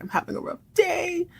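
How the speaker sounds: high, soft imitated weepy voice; hammed-up little whimper; mock crying. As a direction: play at tearful self-pity. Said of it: "Lorraine cries".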